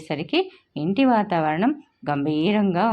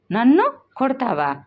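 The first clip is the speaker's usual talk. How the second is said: put-on raised, higher child's cry of outrage, stress on నన్ను; angry cry; 6 semitones higher, 3 dB louder than usual. A narrator's reading. Girl yelling at her mother who slaps her, angry and raised. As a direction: yell నన్ను కొడతావా with fury. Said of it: "పూజ ఆవేశంతో అరిచింది".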